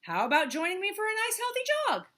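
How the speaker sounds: fast, high, loud sales pitch; pushy Hippo. Acted. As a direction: rush the invitation. Hippo recruiting.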